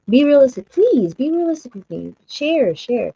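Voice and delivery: high-pitched, emphatic appeal to viewers; insistent plea. Real, not acted. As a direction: plead with them, pitch lifted. Pleading with viewers to engage.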